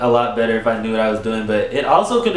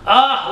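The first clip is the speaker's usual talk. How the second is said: short high yelp of pain; a retold cry of pain; pained scream; 11 semitones higher, 4 dB louder than usual. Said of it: "I was literally, like, screaming".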